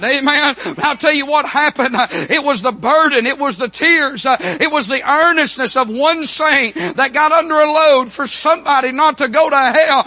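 high, driving revival preacher cry; full-force preaching. Acted. Telling the congregation of a son at the altar, impassioned preaching. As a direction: preach it out in long rising waves.

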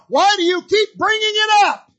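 sudden high, loud outburst of indignation; indignant retort. Retold or imitated. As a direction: snap back, fed up with being reminded. Rahab retorting to her accuser.

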